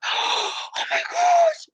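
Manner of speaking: very high, slow delighted "Oh my gosh"; surprised. Shown as a demonstration. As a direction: gasp it out like you just got a present. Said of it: "with what? A lot of energy".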